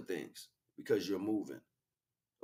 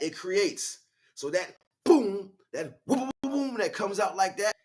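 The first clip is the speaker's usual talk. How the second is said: much higher and louder, climactic sermon; full-force preaching; 5 semitones higher, 6 dB louder than usual. Acted. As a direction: let it boom like a revival peak.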